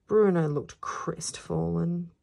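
ordinary delivery

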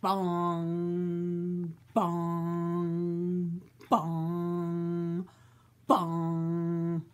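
low, drawn-out bell bongs. Acted. Reader booms out the big bell's bongs. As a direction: ring out each bong like a big bell.

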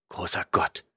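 breathless gasped whisper. A narrator's reading. Dixon gasps at the professor's news, whispered. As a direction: gasp it out in shock, barely voiced. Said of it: "keuchte ich".

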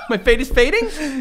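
slow, laughing disbelief. Real, not acted. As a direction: laugh through the words.

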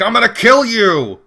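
loud, high hammed-up threat; mock yell. Gamer imitating Dan bursting in on Faye, mock yell. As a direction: yell it like a charging game character. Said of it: "I think Dan just yelled".

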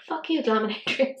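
ordinary talk, nothing marked

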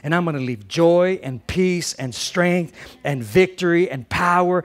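loud, rising preacher's declaration; preaching climax. Real, not acted. Pastor leading the congregation's declaration over music, loud and rising. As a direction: build it to a triumphant close.

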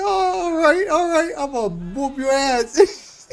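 high, crying with laughter; laughing falsetto quote. Retold or imitated. Viewer retelling a fighter's taunt, laughing high. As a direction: squeak it out through laughter. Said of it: "He said".

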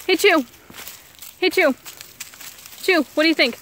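high, loud coaxing voice for the dog; sing-song dog-owner voice; high pet talk. Real, not acted. Calling the dog on a hike.

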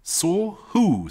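high, alarmed question; nervous terror. A narrator's reading. Wife questioning her frightened husband, nervous. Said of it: "beginning to share in her husband's nervous terror".

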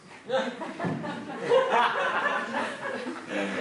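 bursts out laughing; real laughter breaking the story; helpless giggling. Real, not acted. Narrator cracks up.

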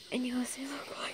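whispered aside; under his breath. Acted. Felix muttering a compliment, whispered under his breath. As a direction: breathe it so he barely hears. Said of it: "said that under his breath".

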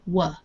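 "w" said as the bare sound "wuh". Shown as a demonstration. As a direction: show "w" as the sound to avoid. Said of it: "not a, not a w".

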